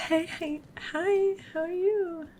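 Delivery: high-pitched cooing hello; gushing greeting. Real, not acted. Player greeting a game character, high gushing hello.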